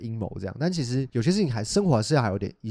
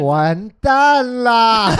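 mock despair; 10 semitones higher, 8 dB louder, much slower than usual. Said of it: "很沮丧，这样说".

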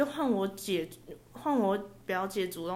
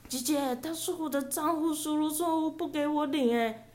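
cousin's plea; 7 semitones higher than usual. Retold or imitated. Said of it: "我表姐就跟他说".

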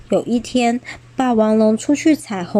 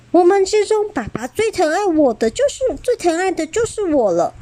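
put-on high proud child voice; smug; 8 semitones higher than usual. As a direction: boast with a grin. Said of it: "得意地说".

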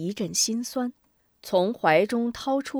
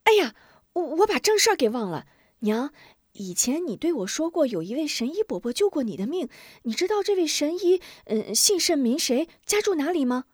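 put-on startled child; 7 semitones higher than usual. A narrator's reading. Boy asking his mother about the doctor, excited. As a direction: blurt it out, suddenly remembering.

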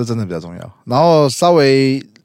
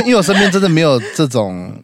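ordinary talk, nothing marked

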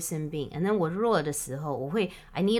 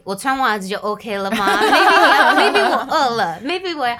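laughing exasperation; 5 semitones higher, 9 dB louder than usual. Retold or imitated. Woman retelling her laughing protest to partner about socks. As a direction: push back through laughter.

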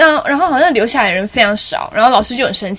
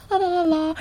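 soft sung la la la, blending in; everyone's soft la la; 7 semitones higher, 7 dB quieter than usual. Shown as a demonstration. Demoing how the class sings it, softly. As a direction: sing it softly like the rest of the class. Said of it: "大家声音可能是".

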